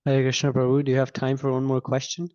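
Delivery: ordinary talk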